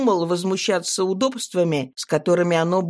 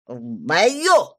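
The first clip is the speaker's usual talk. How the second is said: put-on proud drunk; 2 semitones lower than usual. A narrator's reading. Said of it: "гордо ответил пьяный".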